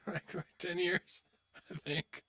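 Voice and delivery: laughing through the words; words lost in a laugh; breathy laugh. Real, not acted. Streamer laughing while teasing old friend Kevin.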